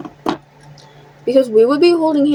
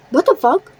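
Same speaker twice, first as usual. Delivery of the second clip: incredulous; 6 semitones higher than usual. Real, not acted. Stunned by date-for-anniversary offer.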